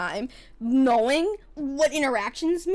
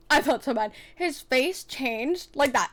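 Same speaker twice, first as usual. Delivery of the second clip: normal talking voice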